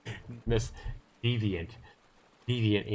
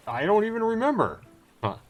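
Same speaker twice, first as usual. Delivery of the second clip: high-pitched, wavering dodge; evasive storyteller; 11 semitones higher, 5 dB louder than usual. Acted. Sailor brushing off a listener's "why", high-pitched and evasive. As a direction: pitch leaps up high on admitting it.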